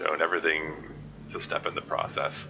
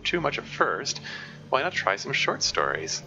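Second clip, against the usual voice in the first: brisk read-aloud voice, a bit higher; 4 semitones higher than usual. Acted. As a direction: read the comic's advice out to the chat. Streamer reads comic's advice text aloud.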